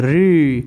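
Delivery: री with the tongue tip curled back; demonstrating री. Shown as a demonstration. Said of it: "जिह्वा की नोक आगे से मुड़ रही". (मुड़ी जीभ से री)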